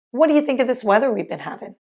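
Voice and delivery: what do you said as what do ya, we've been havin' run together; quick, run-together casual question; rapid conversational contractions; fast reduced speech. Shown as a demonstration. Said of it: "Let's put it all together".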